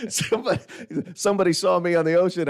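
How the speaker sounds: normal talking voice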